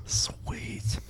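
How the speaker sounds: hushed whispered approval. Real, not acted. Cohost whispers while they wait for the call. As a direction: breathe it out in a whisper.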